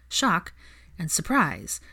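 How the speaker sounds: usual speaking voice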